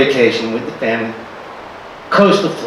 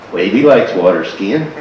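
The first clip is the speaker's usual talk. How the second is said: usual speaking voice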